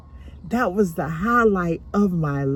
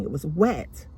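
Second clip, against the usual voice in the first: normal talking voice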